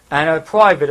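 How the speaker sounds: ordinary delivery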